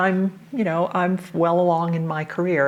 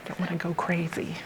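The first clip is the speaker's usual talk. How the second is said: library whisper; 6 dB quieter than usual. Real, not acted. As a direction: keep your voice down in the library. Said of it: "now we can use our regular voices again".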